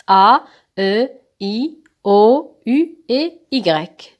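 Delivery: name each vowel slowly and clearly; slow, spelled-out vowels. Shown as a demonstration. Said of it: "je vais entourer les six voyelles".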